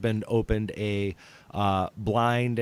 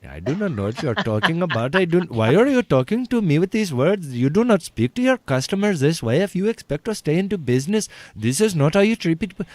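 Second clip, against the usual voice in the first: rapid, higher-pitched put-on Indian accent; East Indian accent; 5 semitones higher, faster than usual. Prank caller scolds a business owner, East Indian accent. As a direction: play an indignant customer with an Indian accent. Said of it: "I was a very East Indian man".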